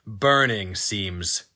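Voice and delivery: usual speaking voice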